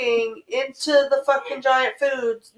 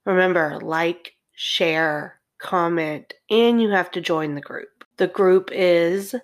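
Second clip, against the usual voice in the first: calm, lower sign-off read; podcast outro; 5 semitones lower than usual. Acted.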